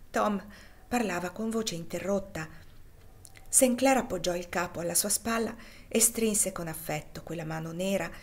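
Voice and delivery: ordinary delivery, nothing marked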